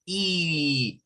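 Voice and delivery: E drawn out as a long ee; teacher showing the wrong long ee sound; wrong long vowel. Shown as a demonstration. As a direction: stretch E into a long, tense ee. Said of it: "It's not E".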